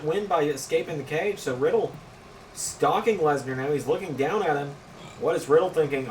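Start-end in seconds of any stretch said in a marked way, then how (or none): none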